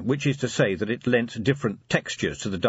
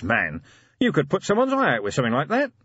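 higher, animated protest; lightly voiced Vimes; 7 semitones higher, 4 dB louder than usual. A narrator's reading. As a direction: protest to the butler, pitched up.

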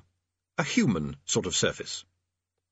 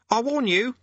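very high, insistent warning; warning conjurer; 13 semitones higher, 3 dB louder than usual. Acted. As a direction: warn off a demon, pitched high. Hidden conjurer warns Rincewind.

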